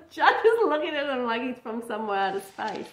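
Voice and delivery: loud, high start broken by a laugh; amused laughter. Real, not acted. Reviewer describing the judge's baffled stare, laughing.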